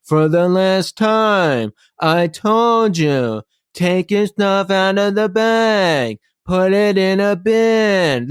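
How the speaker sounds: slow, drawn-out, mocking airport agent; sarcastic agent. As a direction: imitate a nasty agent talking down to the line. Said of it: "in a really sarcastic voice".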